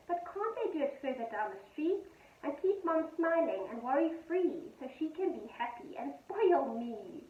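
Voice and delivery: gentle sing-song poem reading; poem read to children. A narrator's reading. Teacher reading a poem to her pupils, gentle and sing-song.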